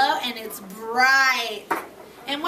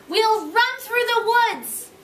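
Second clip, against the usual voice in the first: excited gasp; 6 semitones higher than usual. A narrator's reading. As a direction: sell the wonder of the woods. Teacher dramatizes story excitement.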